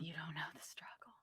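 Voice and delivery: breathy unvoiced whisper. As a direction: hush it out, no voice at all. Repeats line in whisper.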